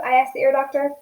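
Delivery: usual speaking voice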